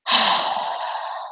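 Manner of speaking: long, breathy exhale; heavy sigh. Real, not acted. Worn out by piles of laundry, long real sigh.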